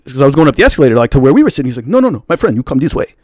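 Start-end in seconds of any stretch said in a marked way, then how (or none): 1.9-3.1 imitated African accent, insistent. Usher corrects the guest's route, in African accent. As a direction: redirect him firmly in an African accent. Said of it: "some dark-skinned African dude".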